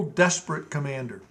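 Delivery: ordinary delivery, nothing marked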